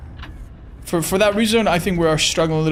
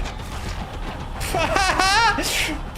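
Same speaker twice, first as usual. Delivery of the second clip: exasperated laugh; 13 semitones higher, 4 dB quieter than usual. Real, not acted. Exasperated laugh at losing him.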